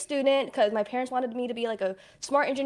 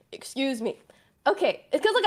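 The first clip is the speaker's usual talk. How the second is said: ordinary delivery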